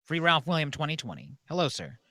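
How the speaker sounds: ordinary talk, nothing marked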